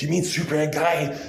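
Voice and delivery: high, loud announcer voice; loud harsh voice. Acted. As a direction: hype it like an announcer. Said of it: "this is my announcer voice".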